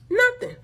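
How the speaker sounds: Nothing punched very high and loud; emphatic NOTHING. Retold or imitated. Scolding the man who never asked, Nothing high and loud. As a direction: hit "Nothing" high, as one indignant word.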